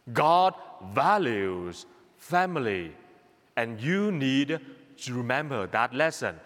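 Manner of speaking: usual speaking voice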